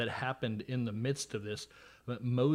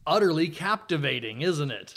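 loud mock enthusiasm, higher pitched; sarcastic; 3 semitones higher, 8 dB louder than usual. Host introducing the census to the class, sarcastic. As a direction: oversell it with heavy irony.

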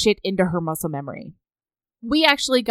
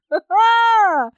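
high delighted cackle; 12 semitones higher, 5 dB louder than usual. Real, not acted.

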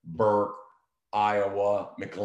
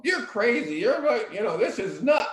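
much higher, hammed-up frantic outburst; imitating a panicked agent, pitched way up; mock frantic agent; 4 dB louder than usual. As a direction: play the agitated agent, mocking. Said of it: "anybody frantic in a transaction".

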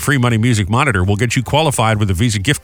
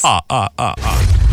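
Count laugh; slower than usual. Retold or imitated. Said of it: "I like the Count".

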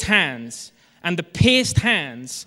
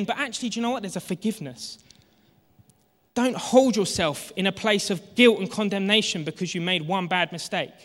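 ordinary delivery

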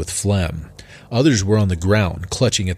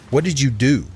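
aghast; 6 semitones higher than usual. Acted. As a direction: demand it in disbelief. Doorman aghast at the ruin.

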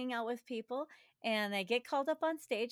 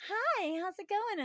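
high, bright sweet greeting; sugary customer service voice; 8 semitones higher than usual. Retold or imitated. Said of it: "in my nice customer service voice".

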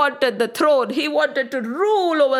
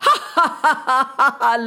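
mocking villain laugh; demon's laugh; faster than usual. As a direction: laugh in the god's face. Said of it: "laughed Tarakasur".

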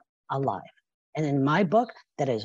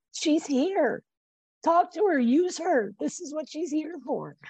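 much higher, lightly voiced guiding spirit; angels speaking; 9 semitones higher than usual. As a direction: speak as a gentle heavenly guide, pitched up. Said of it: "my angels and my guides saying".

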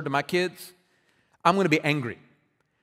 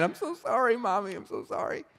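put-on crying child; 5 semitones higher, 5 dB quieter than usual. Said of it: "I'd just start crying".

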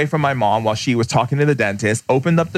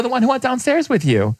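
high mock voice; 11 semitones higher than usual. Retelling his answer to the woman.

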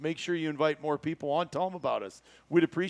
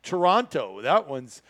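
ordinary talk, nothing marked

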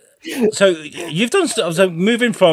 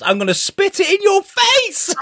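excited. Real, not acted. Ranting about cold tea.

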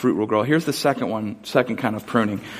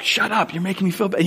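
imitating his dad snapping back, pitched higher; a playful, higher mock retort; mock exasperated dad; 7 semitones higher than usual. Dad answering the whimpering tree, higher mock snap.

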